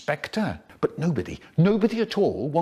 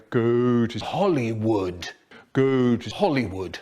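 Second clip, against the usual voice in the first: spooky voice; 4 semitones lower than usual. A narrator's reading. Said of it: "talking in his spooky voice".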